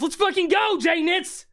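high, shouted hype; excited cheer. Real, not acted. Streamer cheering a viewer who bought a house, excited. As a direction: cheer them on with pitch way up.

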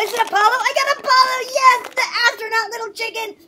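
loud, high cry of triumph; excited. Real, not acted. Kid pulling out the astronaut toy, excited. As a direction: burst out cheering at the find.

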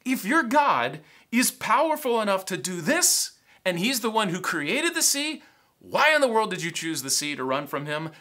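loud, high, incredulous scolding; exasperated sailors. Acted. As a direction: berate him like a furious sailor. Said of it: "they're like".

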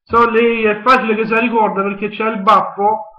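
ordinary talk, nothing marked

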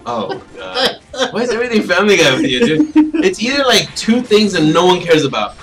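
loud, high, incredulous complaint; exasperated rant. Real, not acted. Fed up with friend's Family Guy references, exasperated rant. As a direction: rail at a friend in comic exasperation.

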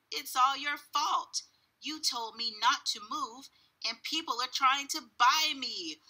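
high, wailing complaint; upset child. A narrator's reading. Taya tells her father it's his fault, upset. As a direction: blame Daddy, close to tears.

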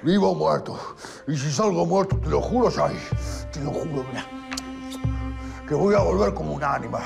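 promesa agudísima de venganza; juramento exaltado. Acted. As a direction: jura con voz que se le quiebra hacia arriba. Preso jura volver como fantasma. (jura exaltado volver como fantasma)

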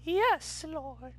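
put-on high, fervent old church lady; mock church grandma praising; churchy grandma. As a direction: cry out like grandma in the pews. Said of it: "talking like my grandma at church".

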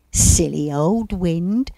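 amused, slow, loud remark; chuckling. A narrator's reading. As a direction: say it with a touch of a chuckle. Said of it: "chuckled Rufus".

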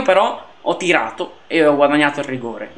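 normal talking voice

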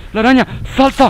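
high, loud panicked cry; loud frightened yell; startled shout. Real, not acted. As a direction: yell in sudden alarm. Spider attacks during jump.

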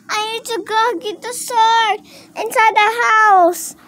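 high-pitched urgent toy voice; high pretend voice. Toy hero hunting for its sword, high urgent pretend voice.

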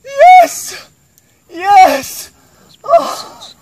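high, loud shouts of triumph; triumphant cheer. Real, not acted. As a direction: cheer at the summit. Reaching the summit, cheering.

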